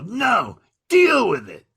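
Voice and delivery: high, loud mock refusal; emphatic refusal. Volunteer turns down comedian a second time, emphatic refusal. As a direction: imitate a heckler digging in stubbornly.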